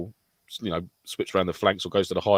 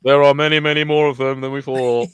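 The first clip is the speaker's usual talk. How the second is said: mock panic; 5 semitones higher, 6 dB louder than usual. Acted panicked radio call.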